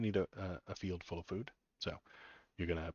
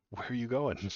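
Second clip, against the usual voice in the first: laughing; 3 semitones higher, 5 dB louder than usual. Real, not acted. Laughing at empty sidewalk.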